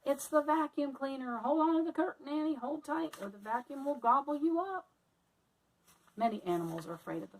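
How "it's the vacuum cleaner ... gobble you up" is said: alarmed warning. Acted. Melissa warning Annie about the vacuum, alarmed. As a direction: shout a warning over the machine.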